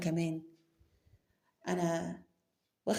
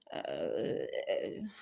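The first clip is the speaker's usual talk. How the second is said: stunned low drawn-out "aah"; 6 dB quieter, much slower than usual. Acted. Trails off in shock.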